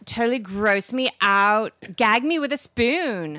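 put-on drawn-out Valley Girl talk; mock Valley Girl. Host mocks Valley Girl talk, exaggerated Valley Girl. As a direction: make fun of Valley Girl speak. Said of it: "meaning to make fun of it".

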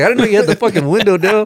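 high, laughing protest; indignant protest. Retold or imitated. Answering his coworker in the van, laughing protest. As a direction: plead innocence while laughing.

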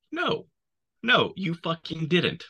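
very high, stunned no; mock incredulous outburst; disbelief. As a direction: squeal it in shocked disbelief. Squad reacting in disbelief.

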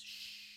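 long unvoiced shush; hushing "shh". Co-host hushing talk about secrets, drawn-out shush. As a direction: hush someone over a secret.

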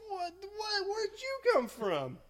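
high-pitched, drawn-out incredulous question; baffled disbelief. Retold or imitated. Helper at college contest to a little guy, acting exasperated disbelief. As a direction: stammer into an exasperated high squeal.

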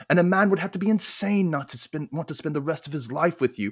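put-on amateur British accent, earnest and halting. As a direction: plead sincerely in an imitated British accent. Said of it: "I wanna do this in a British accent".

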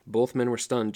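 plain reading voice

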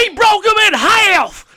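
high shouted announcer call; JR impression. Co-host doing the commentator's famous call, shouting. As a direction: bellow it like a stunned ringside commentator. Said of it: "as good old J R would say".

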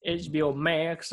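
slow Southern twang; put-on Southern accent. Podcaster twisting the streaming name, slow Southern accent. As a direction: stretch "Max" with a Southern drawl. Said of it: "they don't talk like this".

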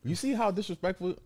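high, exaggerated indignation; hammed-up hurt at the commenter; mock offense. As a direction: play up being insulted.

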